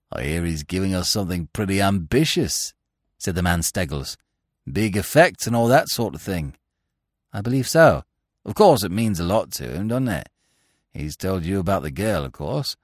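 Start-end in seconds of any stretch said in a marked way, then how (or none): 0.1-2.7 lower, softer smug voice of a sly rival; a lower, quieter oily cad. A narrator's reading. Steggles voiced in narrated story. 4.7-12.8 sly rival. A narrator's reading. Steggles voiced in narrated story.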